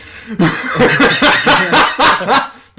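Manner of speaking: loud, high burst of laughter; big laugh. Real, not acted. Game master bursts out at player's teleport joke, big laugh. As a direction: crack up hard and loud.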